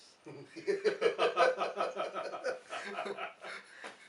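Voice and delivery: long, loud belly laugh. Real, not acted. Teacher joking about students needing a lift to class, laughing hard. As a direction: burst into big rolling laughter.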